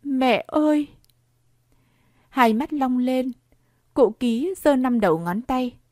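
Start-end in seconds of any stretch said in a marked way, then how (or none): 0.0-0.9 high, plaintive wail; a small high cry of grief; whimpering lament. A narrator's reading. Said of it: "chị ti tỉ".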